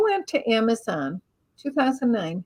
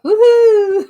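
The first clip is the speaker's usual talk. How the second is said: much higher, loud joyful cheer; gleeful whoop; 9 semitones higher, 10 dB louder than usual. Retold or imitated. A soul out of the body cheering, high loud whoop. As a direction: whoop like a carefree light being. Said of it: "I'm like".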